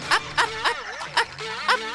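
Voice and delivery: squeaky praising a dog. Acted. Ordering child like a dog.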